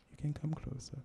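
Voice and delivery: hushed invitation. Acted. Poet inviting the listener closer, in a hush. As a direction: draw them in with a near-whisper.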